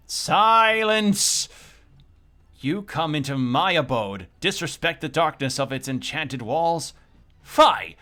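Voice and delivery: loud, archaic, slow rage; a cave hermit roaring thou and fie; furious old-timey rant. Acted. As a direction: bellow at the intruder to be silent. Grumpy cave dweller scolds intruder.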